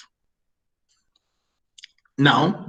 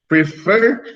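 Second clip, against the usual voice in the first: "prefer" with the second syllable raised; stress on second syllable; 3 semitones higher than usual. Shown as a demonstration. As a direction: lean on the FER in "prefer". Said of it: "the stressed syllable is the second".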